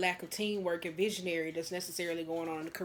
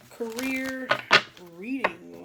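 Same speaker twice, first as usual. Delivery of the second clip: ordinary talk, nothing marked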